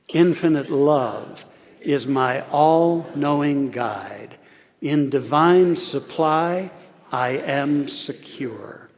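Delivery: slow, evenly measured affirmation; leading group recitation. Shown as a demonstration. Preacher leading the congregation in unison, slow and deliberate. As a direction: draw it out so everyone can join in. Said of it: "Say this with me".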